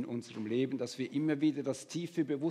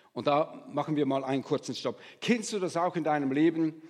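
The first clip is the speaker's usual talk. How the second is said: usual speaking voice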